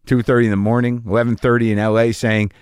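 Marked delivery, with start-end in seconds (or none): none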